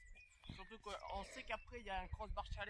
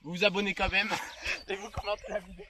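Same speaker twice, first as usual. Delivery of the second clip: louder, laughing repeat; 15 dB louder than usual. Real, not acted. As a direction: push it louder, laughing through.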